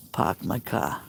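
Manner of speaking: put-on a low echo in a Boston accent, R dropped. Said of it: "The Bostonian accent is".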